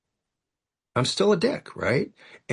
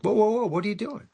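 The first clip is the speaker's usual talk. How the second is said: high-pitched, startled cut-in; alarmed interruption; 9 semitones higher than usual. Retold or imitated. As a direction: stop them short, pitch jumping up in bewilderment. Stops grand sponsor mid-reading, startled.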